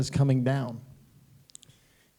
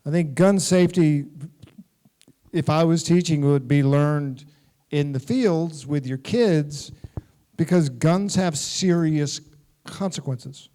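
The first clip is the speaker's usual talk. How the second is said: ordinary talk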